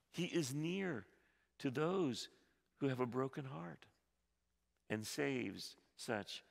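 ordinary delivery

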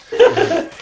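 shrieking laugh; high burst of laughter. Real, not acted. Laughing at brutal kill.

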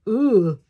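loud pained grunt; grunt on impact. Acted. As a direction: grunt as if hit by a ball. Player hit during practice.

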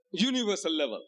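ordinary talk, nothing marked

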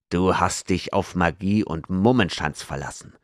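ordinary delivery

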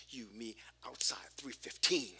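whispered, voiceless challenge; bully's whisper. Retold or imitated. Schoolyard bully whispering a fight challenge to the skinny kid. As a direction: hiss the dare under the breath. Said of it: "He's like".

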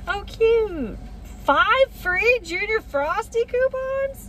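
high, slow and thrilled at the freebie; delighted. Real, not acted. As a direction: squeal over the cute coupons. Delighted at coupon offer.

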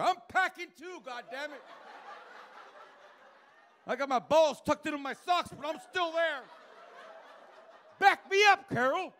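high, loud mock boast in Roger's voice; comic voicing Roger bragging to his wife, hammed-up and loud. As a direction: ham up the old man bragging.

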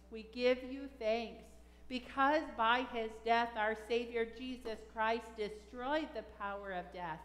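ordinary delivery

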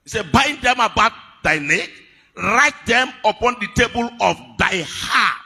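high, loud scripture proclamation; forceful preaching. Acted. Preacher charging the church to wear mercy and truth, forceful preaching. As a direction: proclaim it to the congregation.

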